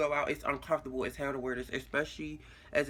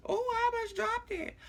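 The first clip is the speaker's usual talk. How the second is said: sudden high startled yelp. Real, not acted. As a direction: squeal as the pill nearly drops. Nearly drops the pill.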